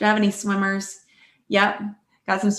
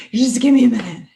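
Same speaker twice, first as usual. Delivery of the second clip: panting plea, louder; out of breath; 3 semitones higher, 6 dB louder, faster than usual. Shown as a demonstration. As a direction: gasp it out, winded. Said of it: "found yourself being out of breath".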